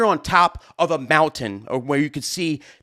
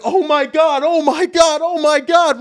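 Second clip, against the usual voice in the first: panicked oh my God; 12 semitones higher, 6 dB louder, slower than usual. Retold or imitated. Gear soaking in downpour.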